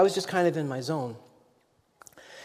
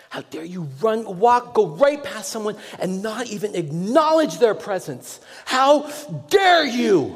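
building from a hushed how dare you to a slow furious shout; growing anger; 6 semitones higher, 4 dB louder, slower than usual. Retold or imitated. Stranger scolding the passing pastor, building to a furious shout. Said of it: "he got more and more angry".

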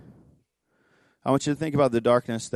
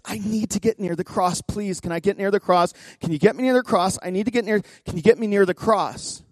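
higher, urgent retold plea; imitating tearful pleading; 8 semitones higher, 4 dB louder than usual. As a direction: plead urgently as the weeping woman, without real sobs. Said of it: "she is weeping".

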